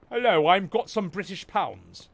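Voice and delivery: mock British accent. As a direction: put on a silly British voice. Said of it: "I got some British pounds".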